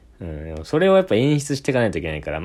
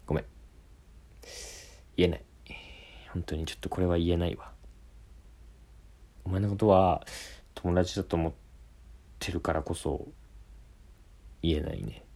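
brooding confession; 2 semitones lower, 8 dB quieter than usual. Shown as a demonstration. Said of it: "思い詰めた感じ".

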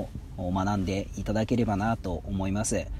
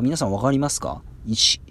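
normal talking voice

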